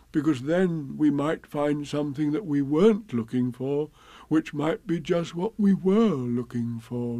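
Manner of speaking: slow ponderous bear voice; slow Pooh. Acted. Pooh explains his plan to Rabbit, slow bear voice. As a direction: reason it out dimly.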